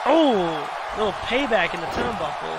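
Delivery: excited live play-by-play calls; play-by-play commentary. Acted. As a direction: call the match like a ringside commentator.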